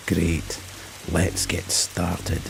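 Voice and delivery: low, slow, quiet detective voice; Taggart. Acted. Detective starting work on the case, low, slow Taggart-like voice. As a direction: channel a dour TV detective, drawn out and low. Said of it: "I'm channeling Taggart here".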